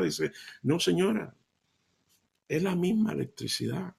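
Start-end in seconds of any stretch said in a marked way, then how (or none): none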